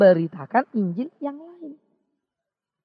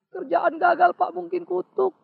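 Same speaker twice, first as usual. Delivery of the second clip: higher, whiny imitation of a sick believer; whiny complainer; 6 semitones higher, 3 dB louder, slower than usual. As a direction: whine that it must be a curse. Congregant blames a curse.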